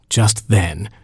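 ordinary delivery, nothing marked

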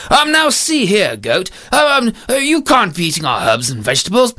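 hesitant, swooping, fussy scolding; bumbling husband. Acted. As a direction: try feebly to tell off a goat. Storyteller voices the husband.

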